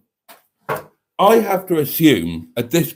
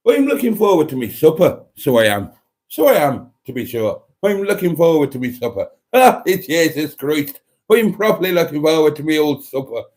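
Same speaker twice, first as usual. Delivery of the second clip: put-on terrible Irish accent; 3 semitones higher, 3 dB louder than usual. Streamer joking about looking forward to supper, bad Irish accent. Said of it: "Irish people who were offended by that terrible accent".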